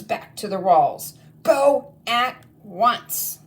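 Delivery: high, loud barked war orders; a witch headmistress giving attack orders to her goblins; commanding witch. Acted. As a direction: order the troops like a furious witch queen. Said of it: "she bellowed".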